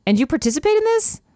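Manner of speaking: high disbelieving question; incredulous. Acted. Narrator-heroine voiced, incredulous.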